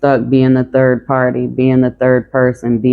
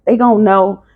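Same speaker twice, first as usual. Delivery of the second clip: higher urging voice; 7 semitones higher than usual. Retold or imitated. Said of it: "And the Most High is like".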